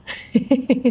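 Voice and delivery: short, helpless giggle; real giggle at the cat's antics; amused laugh. Real, not acted. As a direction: let a quick chuckle slip out. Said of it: "she is making me laugh right now".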